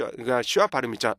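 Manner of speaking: normal talking voice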